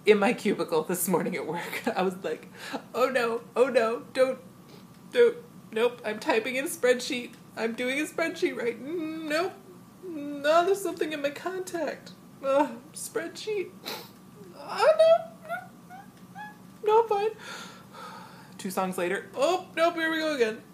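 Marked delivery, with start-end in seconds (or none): 2.9-17.3 hammed-up choked-up whimper; fighting back tears. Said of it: "I cried listening to the album". 19.4-20.6 high mock-tearful squeak; hammed-up weepy resignation; tearing up again. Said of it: "I cried listening to the album".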